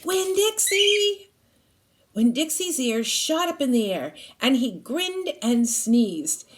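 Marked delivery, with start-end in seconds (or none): 0.0-1.3 high, slow call across the yard; hollering a name. A narrator's reading. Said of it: "went to the trailer door and hollered".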